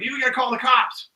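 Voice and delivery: imitated high, urgent plea; frantic demand. Storyteller acts a robbed man demanding help from the clerk, frantic. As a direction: re-enact a panicked customer's cry. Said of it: "and he's screaming".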